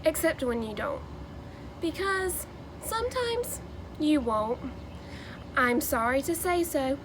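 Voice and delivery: lively, sing-song picture-book read-aloud; animated Dr. Seuss storytelling with rhyming bounce; reading to children. Acted. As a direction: read it like story time for a class of kids.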